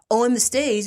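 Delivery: usual speaking voice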